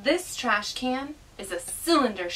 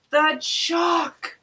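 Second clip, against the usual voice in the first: gasp, then louder and higher exclamation; surprised gasp; 7 semitones higher, 7 dB louder than usual. Acted. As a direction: act out the sudden discovery. Gasps finding the chalk.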